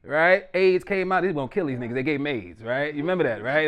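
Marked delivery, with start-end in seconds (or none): none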